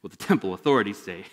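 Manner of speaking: normal talking voice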